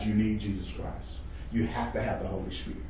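low, very soft preacher's close; hushed earnestness. Real, not acted. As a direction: drop right down, low and quiet. Hushed close of a forceful passage.

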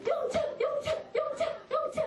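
high heave-ho chant; lightly voiced trudging. Young David hurrying on his father's errand, high heave-ho.

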